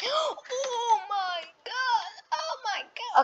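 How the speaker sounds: gasping high oh my god; shocked gasp. Real, not acted. Gamer stunned when his character is attacked, high gasp. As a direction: gasp then cry it out, stunned.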